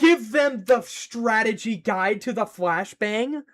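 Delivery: high, slow, drawn-out yell; stretched-out outraged scolding; loud protest. Real, not acted. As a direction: shout at them in exasperation. Protesting at chat.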